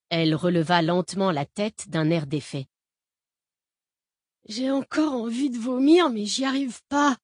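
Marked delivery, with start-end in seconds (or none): 4.5-7.2 high whiny moan. A narrator's reading. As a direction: moan it pitifully, feeling sick. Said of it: "gémit-elle".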